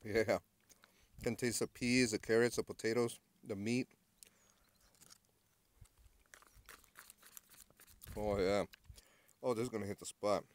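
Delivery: talking with mouth full; chewing between words, mouth full; wolfing down food. Real, not acted. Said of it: "I'm being a pig".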